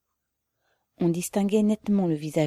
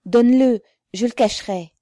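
loud, high opening, easing down; urgent demand; 6 semitones higher, 8 dB louder than usual. A narrator's reading. Comrade wants to hide Pavel's flag, urgent loud demand. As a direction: demand it urgently, then ease off.

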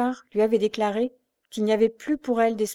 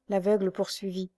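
ordinary delivery, nothing marked

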